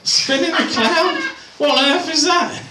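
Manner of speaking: high-pitched baffled repeat; incredulous. Acted. Comedian baffled by his own quiz answer, incredulous and high. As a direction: question it in high disbelief.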